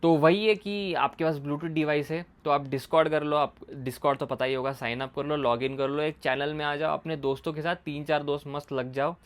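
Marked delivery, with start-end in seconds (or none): none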